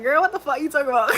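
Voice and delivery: quick, mock incredulous friend; imitating friends. Friends mocking her for saying sherbet, quick and incredulous. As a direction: fire it off like a baffled friend. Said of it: "They're gonna be like".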